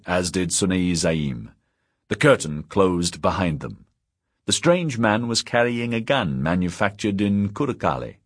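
ordinary delivery, nothing marked

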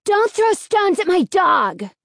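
slow, high, loud shout; boy shouting. A narrator's reading. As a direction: shout to protect his dog. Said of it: "the boy shouted".

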